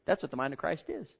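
normal talking voice

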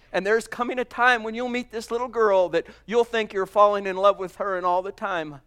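usual speaking voice

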